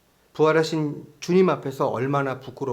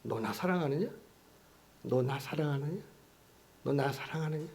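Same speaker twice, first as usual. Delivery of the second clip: soft repeated question; 9 dB quieter than usual. A narrator's reading. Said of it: "계속".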